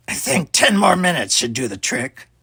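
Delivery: a confident thief counting down; smug villain. A narrator's reading. Villain Talus gloating.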